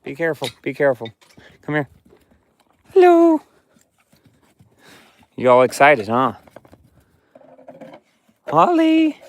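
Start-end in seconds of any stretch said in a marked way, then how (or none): 2.9-3.4 much higher, louder sing-song hello; pet talk. Real, not acted. Owner greeting his excited dog in a high pet voice. 8.5-9.2 much higher cooed name; pet talk. Real, not acted. Owner cooing to his dog Holly in a high voice.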